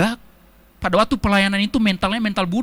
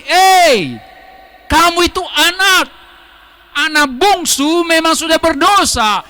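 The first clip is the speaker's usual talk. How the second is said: higher, louder, building to a shout; urgent calling; 7 semitones higher, 4 dB louder than usual. Retold or imitated. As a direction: call the lost child home. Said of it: "Roh Kudus ingatkan lagi". (seruan keras memanggil anak kembali)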